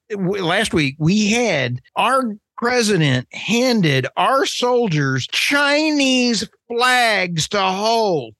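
getting hot under the collar, voice rising; worked up. Real, not acted. Ranting to co-host Michael about the president, real worked-up anger. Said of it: "Sorry for getting worked up".

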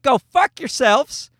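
put-on old James gloating. Grown son at seventy mocks insurers, gloating old man. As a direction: crow it like a smug grandpa. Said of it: "He's like".

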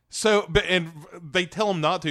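ordinary talk, nothing marked

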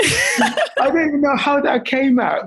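high laugh through words; laughing in disbelief. Real, not acted. Laughing after the bluff.

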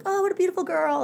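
cooing stranger. Retold or imitated. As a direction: gush like a stranger admiring an infant. Strangers cooing over baby.

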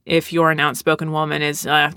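usual speaking voice

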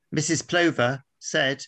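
ordinary talk, nothing marked

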